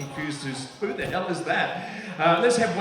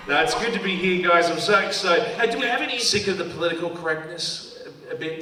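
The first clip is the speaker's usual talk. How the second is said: ordinary delivery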